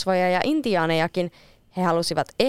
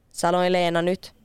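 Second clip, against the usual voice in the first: ordinary delivery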